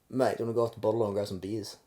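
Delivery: quick, soft run-together question; hurried Kiwi talk. Shown as a demonstration. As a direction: rattle it off, no time to waste. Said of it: "We just don't have time".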